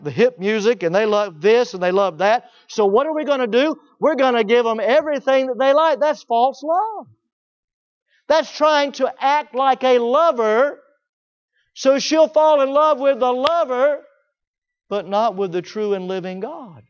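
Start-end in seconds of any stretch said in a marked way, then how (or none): 2.7-14.0 higher-pitched, driving sermon delivery; raised, impassioned preacher's voice; forceful preaching. Real, not acted. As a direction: press the point home from the pulpit.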